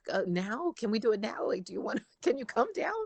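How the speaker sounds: high, loud imitated pleading; impatient excited dad. Dad begging daughter to come down, impatient. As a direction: pester eagerly, like dad wanting it now. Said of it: "he'd start to get frustrated".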